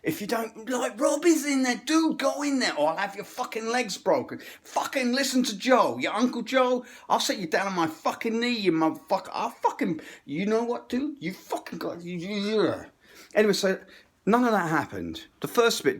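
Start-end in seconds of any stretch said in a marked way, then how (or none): 1.0-12.7 mock mobster threat. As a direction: menace him like Uncle Joe the mobster. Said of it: "giving me the Italian".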